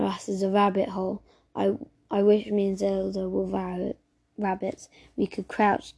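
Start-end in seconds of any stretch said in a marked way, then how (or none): none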